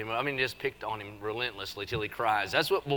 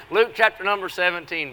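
louder, higher bark of the text; loud call to order; 6 semitones higher, 9 dB louder than usual. Real, not acted. Preacher hauling the laughing crowd back, louder.

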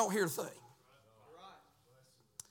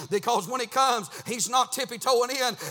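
driving, rhythmic pulpit cadence; fervent preaching; 4 semitones higher, 7 dB louder than usual. Real, not acted. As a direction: preach it hard in short urgent bursts. Preaches at full force.

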